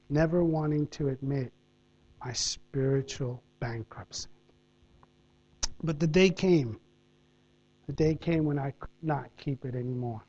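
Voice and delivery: soft, low preaching with long pauses; sermon delivery. Acted. Preacher applies the story to the congregation, soft and low. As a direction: draw the congregation in quietly.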